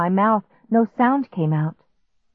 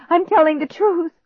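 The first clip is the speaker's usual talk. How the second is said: higher, insistent plea; desperate pleading; 11 semitones higher, 4 dB louder than usual. A narrator's reading. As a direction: beg to be believed. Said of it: "I pleaded".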